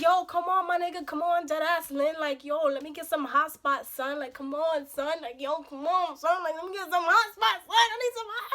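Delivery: high, loud whiny begging, imitating the date; mock whining. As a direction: nag and plead, pitch climbing as it goes on. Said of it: "So he's whining".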